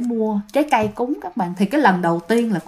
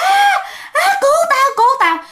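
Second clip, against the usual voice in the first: shrieking for help, much higher and louder; terrified scream; 3 dB louder, faster than usual. Retold or imitated. Thi screaming at her coworker for help, panicked shriek. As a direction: scream like the ghost has your face. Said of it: "bạn la quá trời la".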